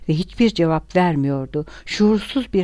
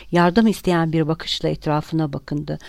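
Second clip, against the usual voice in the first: ordinary delivery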